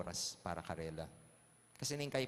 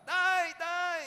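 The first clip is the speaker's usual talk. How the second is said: very high, loud drawn-out shriek; acted piercing kid's cry for help; child screaming for dad; 10 dB louder than usual. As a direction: yell out for your father at full pitch. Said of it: "sumisigaw pa rin yung bata".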